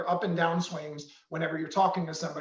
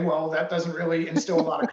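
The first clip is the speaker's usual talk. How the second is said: ordinary delivery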